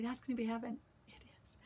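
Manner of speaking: hushed, breathy whisper of the line; whispered "heaven" aside. Real, not acted. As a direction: let it slip out as a secret whisper. Aside, repeated then said plainly.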